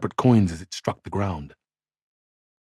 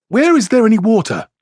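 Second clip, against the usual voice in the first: urgent; 13 semitones higher, 6 dB louder than usual. A narrator's reading. Man seeking water for dying lord.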